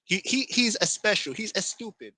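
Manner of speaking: high mock Spanish accent; Spanish accent adding an e before s. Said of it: "you put a E in front of every word that begins with the letter S".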